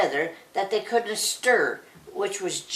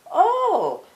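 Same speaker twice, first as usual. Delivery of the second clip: startled oh; 12 semitones higher, 5 dB louder than usual. A narrator's reading. Suitor startled.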